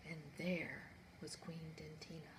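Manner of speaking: hushed storyteller whisper on her name; a reveal whispered to the children. A narrator's reading. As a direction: breathe the name like a secret. Dramatic reveal of the queen.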